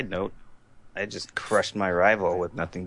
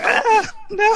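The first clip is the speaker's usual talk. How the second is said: mock panicked cry; 5 dB louder than usual. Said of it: "you're just like".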